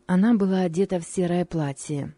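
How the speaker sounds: ordinary delivery, nothing marked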